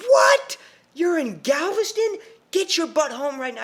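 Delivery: high, loud imitation of a furious mom; livid mom. Mom learns her son is in Galveston, furious high shouting. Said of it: "And she's livid. She's furious".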